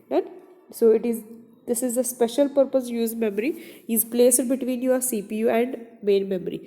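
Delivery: usual speaking voice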